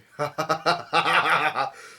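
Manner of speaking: loud burst of staccato laughter; acted laugh. Laugh ending an acted quote.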